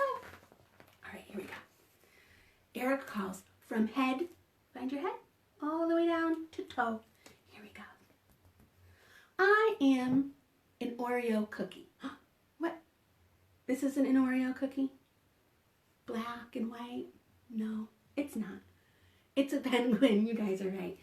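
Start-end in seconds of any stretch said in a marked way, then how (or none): 0.0-1.5 whisper. Real, not acted. Whispered aside. 7.5-7.8 whisper. Real, not acted. Whispered aside. 16.1-18.6 whisper. Real, not acted. Whispered to children.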